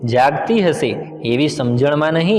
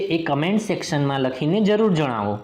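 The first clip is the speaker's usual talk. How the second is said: outro talk; 3 semitones higher, 4 dB quieter than usual. Real, not acted. The narrator asks viewers to like and subscribe, in a chatty sign-off. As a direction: wrap up and ask for likes. Channel outro after story.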